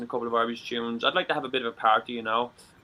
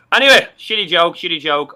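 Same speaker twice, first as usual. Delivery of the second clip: loud self-mocking; 2 semitones higher, 10 dB louder than usual. Real, not acted. Loud self-deprecating remark.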